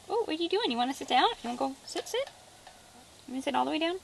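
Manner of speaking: high, gentle coaxing to a pet; coaxing pet talk. Real, not acted. Coaxing the turkey to sit down, high soft pet talk. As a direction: sweetly coax the bird to settle.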